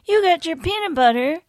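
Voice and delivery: high, excited ad-actor shout; commercial actor voice. Retold or imitated. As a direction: belt it like an old TV commercial. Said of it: "I remember that".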